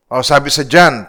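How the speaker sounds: ordinary talk, nothing marked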